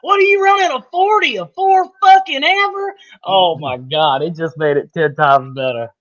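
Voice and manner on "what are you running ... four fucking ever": put-on high, loud country coach yell in a Southern accent. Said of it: "Coach Rao had this old country ass accent".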